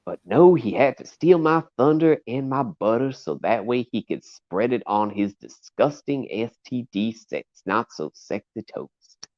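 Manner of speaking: put-on Southern-accented little braggart persona; Tiny Tug. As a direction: play up the aggrieved Southern character, with swooping pitch. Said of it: "I represent the whole persona of Tiny Tug".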